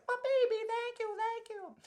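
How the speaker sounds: high, grateful mama cat. Acted. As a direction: thank them like a relieved mother cat. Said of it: "the kitty cat's mama says".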